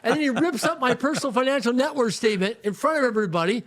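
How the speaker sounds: high, carrying public announcement to the whole room. Retold or imitated. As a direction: announce it to the packed restaurant, slowing on flat broke. Mentor announces to packed restaurant.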